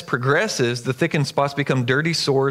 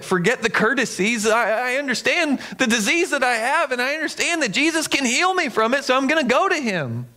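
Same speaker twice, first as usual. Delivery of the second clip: higher-pitched, resolute outburst; determined believer; 8 semitones higher than usual. Acted. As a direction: build up high with conviction, as the desperate believer. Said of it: "and we're like".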